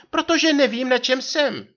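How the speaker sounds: high, loud cry of an exasperated wife; manželčin výkřik. A narrator's reading. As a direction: exclaim in a put-on high wife voice. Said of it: "vykřikla". (rozčilený výkřik vysokým hlasem)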